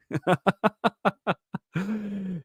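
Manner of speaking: long high cackling laugh; helpless laughter. Real, not acted. Host tickled by a viewer's pun, laughing hard. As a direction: crack up completely.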